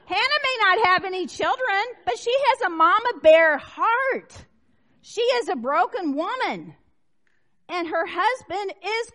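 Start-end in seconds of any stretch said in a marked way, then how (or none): 0.1-4.5 laughing. Real, not acted. Laugh heard on line.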